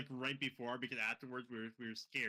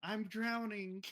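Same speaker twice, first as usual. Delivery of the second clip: high, drawn-out drowning wail; ghostly lady; 9 semitones higher, 3 dB louder, much slower than usual. Retold or imitated. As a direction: imitate a drowning woman, slow and high. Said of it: "we hear a lady behind us".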